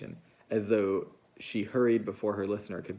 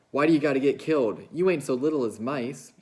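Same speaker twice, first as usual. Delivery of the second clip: raised, louder, faster angry outburst; sudden anger; 5 semitones higher, 7 dB louder than usual. A narrator's reading. Lenny lashing out at the dead puppy, angry and loud. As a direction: let the anger burst out at the puppy. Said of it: "Suddenly his anger rose".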